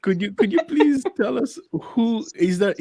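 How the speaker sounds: usual speaking voice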